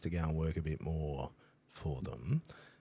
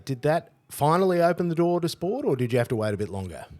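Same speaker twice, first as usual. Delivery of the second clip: higher and louder, rushing to the end; lively excited question; 10 semitones higher, 9 dB louder than usual. Real, not acted. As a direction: fire the question off eagerly. Excited question after the laughter.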